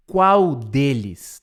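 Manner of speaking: qual deles said very slowly; drawn out translation for learners. Shown as a demonstration. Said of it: "Which of them?".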